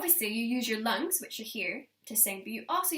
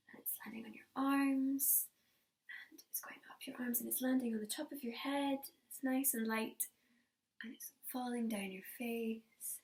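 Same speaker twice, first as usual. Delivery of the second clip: whispering; 8 dB quieter than usual. Acted. Whispered snow game with children.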